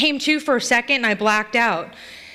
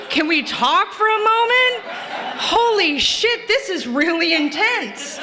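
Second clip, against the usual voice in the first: high, drawn-out comic plea; mock pleading; 9 semitones higher than usual. Drunk driver reasoning with officer beating her, mock pleading. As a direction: plead with absurd politeness, slowing down. Acted protest during a beating.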